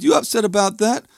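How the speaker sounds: high, rising incredulous question; retold disbelief, voice lifted high. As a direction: question it in disbelief, pitch climbing. Retelling his disbelief at her upset.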